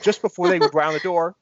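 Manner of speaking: ordinary delivery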